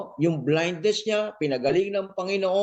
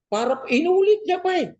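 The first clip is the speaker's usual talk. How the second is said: 1 rising preacher fervor; 12 semitones higher than usual. Acted. Preacher rises in pitch.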